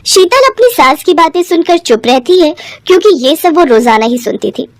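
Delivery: usual speaking voice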